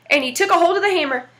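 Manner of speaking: ordinary delivery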